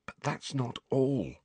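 soft, secretive near-whisper; hushed voice. Acted. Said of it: "a voice only a little above a whisper".

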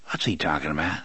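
low, soft question; low villain voice. A narrator's reading. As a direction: ask it low and quiet, as the boss. Tick-Tock asks, puzzled and menacing.

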